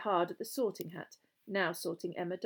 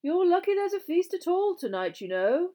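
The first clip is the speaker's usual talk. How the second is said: high, loud, fussy gentleman ghost; ghostly gentleman; 11 semitones higher, 8 dB louder than usual. Acted. House ghost comments on the new pupils, high hopeful voice.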